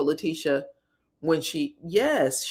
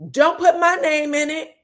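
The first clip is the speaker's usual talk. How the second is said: high, louder mock yell; retold scream; 9 semitones higher, 5 dB louder than usual. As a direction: call it out in imitated protest. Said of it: "has the nerve to scream out".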